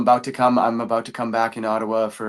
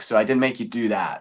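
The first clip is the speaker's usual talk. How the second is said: ordinary talk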